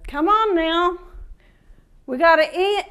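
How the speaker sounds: normal talking voice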